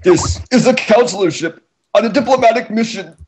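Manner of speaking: very high strained voice; high desperate choked protest; choked plea. Acted. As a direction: squeak it out while being strangled. Orion pleading while choked.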